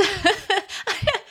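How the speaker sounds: high-pitched giggling laugh; laughing helplessly, high; burst of laughter. Real, not acted. Laughs at eggplant answer.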